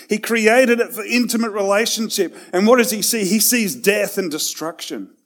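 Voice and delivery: preaching with building force, punching each "created"; rising preaching. Acted. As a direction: lift the room, hammering "He created it".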